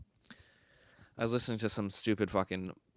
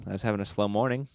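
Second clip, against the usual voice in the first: ordinary talk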